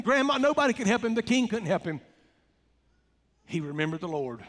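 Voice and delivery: ordinary delivery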